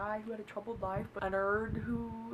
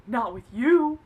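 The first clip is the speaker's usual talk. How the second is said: loud, punched put-down; dismissive; 11 dB louder, slower than usual. Retold or imitated. Man rejecting her over handcuffs, dismissive. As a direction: throw it back as a rejection.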